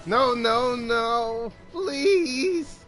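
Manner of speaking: drawn-out plea, climbing high on please; pleading no's that rise into a whine; desperate pleading. Real, not acted. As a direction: beg loudly as it goes wrong. Pleading with the game.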